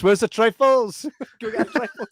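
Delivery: hammed-up order to staff; mock boss. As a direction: shout for trifles like a manager. Mock boss shouting for trifles.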